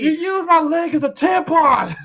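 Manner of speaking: very high, slow falsetto yell; hammed-up high-pitched accusation; mock outraged wail. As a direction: draw it out in a squealing fake outrage. Quoting outraged man from film scene.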